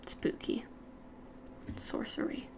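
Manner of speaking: soft spooky whisper. Pretend. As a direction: hush it like a ghost story.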